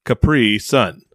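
slow "Capri Sun", long "pri", separate "Sun"; copied emphasis on PRE. Shown as a demonstration. Friend trying out the corrected way, slow PRE emphasis. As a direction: try "Capri Sun" carefully with a stretched "pri". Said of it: "I put a big emphasis on the pre".

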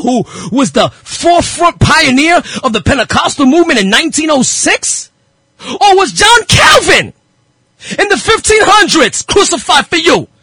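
slow, ringing rhetorical questions; impassioned preaching. Real, not acted. Preacher challenging followers of church pioneers, fired up. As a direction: hammer out each challenge from the pulpit.

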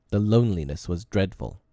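ordinary delivery

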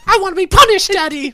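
hammed-up shrieking Mozart; mock squealing Mozart. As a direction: squeal like a spoiled child begging. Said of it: "He's like".

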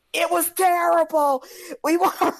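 loud, high outraged protest; angry moviegoers. Retold or imitated. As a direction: act out a crowd demanding refunds. Acting out angry moviegoers demanding refunds.

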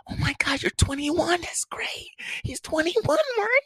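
high, soft, astonished gushing; girlfriend's mama. Retold or imitated. Mama reacting to boyfriend's age, high excited voice. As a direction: imitate a thrilled mother up high.

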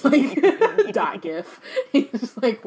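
through laughter, slow; giggling through the line; laughing. Real, not acted. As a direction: laugh it out while speaking. Laughing at own joke.

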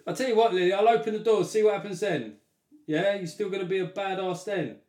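high, loud mock challenge; taunting the dog. Real, not acted. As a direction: dare the dog to act tough.

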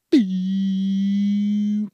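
high, loud electronic beep; high mock laser beep; laser gun beep. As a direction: imitate a ray gun charging up. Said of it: "That's the gun powering up".

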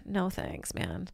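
answering the commenters with a soft, slow brush-off; dry refusal. Retold or imitated. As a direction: shrug it off under your breath. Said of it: "I'm like".

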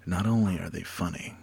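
plain start, then dropping to a whisper; the last words barely breathed out; whispered second take. Shown as a demonstration. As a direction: save the payoff for a near-silent whisper. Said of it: "Take two".

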